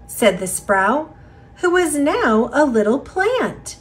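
ordinary delivery

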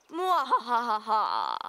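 slow cackling villain laugh; hammed-up evil cackle. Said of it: "I've been practicing my evil laugh".

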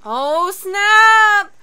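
loud, stretched-out surprise; stunned exclamation. Real, not acted. Reacting to a sudden twist.

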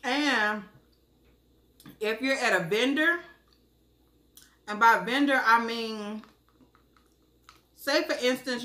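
talking while chewing food; slightly muffled by food; mouth full, chewing. Real, not acted. Seen 3: Took a bite before; jaw and lips show chewing mid-speech.

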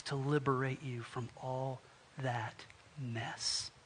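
low, soft, measured reassurance; kind rescuer. Acted. New landlord offering to free an indebted tenant, low tender assurance. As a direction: promise help quietly and sincerely. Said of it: "And then the guy says".

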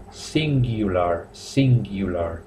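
"singular" slowly, U said as "you"; careful, slow English "singular" with a y-glide; singular with "you" sound. Shown as a demonstration. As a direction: draw out "singular" as "sing-gyu-lar". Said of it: "Y suena la palabrita singular".

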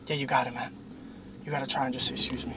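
whispering. Real, not acted. Whispering advice in the car.

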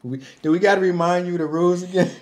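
hammed-up exasperation; mock scolding. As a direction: nag her like a fed-up producer. Mocking the producers scolding her.

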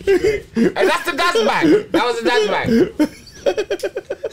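high-pitched, laughing through the words; helpless laughter. Real, not acted. Cracking up with co-hosts over the dad's bag mix-up, real laughter. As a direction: squeal it out while really cracking up.

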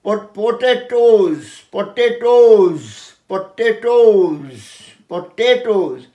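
পটেটোজ drawn out as po-tay-toze; correct pronunciation. Shown as a demonstration. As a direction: drill পটেটোজ slowly, long tay and toze. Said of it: "কিন্তু উচ্চারণটা তোমাদের".

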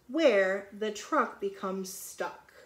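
slow and loud, savoring each word; drawn-out read-aloud; reading to children. Acted. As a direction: stretch it out like a bedtime book. Reads picture book aloud.

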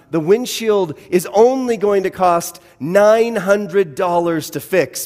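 ordinary delivery

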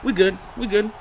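ordinary talk

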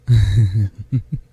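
brief, playful laugh; little amused laugh; teasing chuckle. Acted. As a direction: chuckle fondly at them. Teasing about cuddling.

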